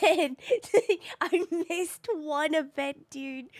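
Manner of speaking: laughing, slow lament. Real, not acted. Streamer telling chat about a missed event, laughing.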